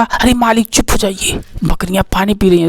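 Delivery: softly voiced servant's hush; mock hushing. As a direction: lightly act out shushing the master, voice dropped. Servant hushes his master in story.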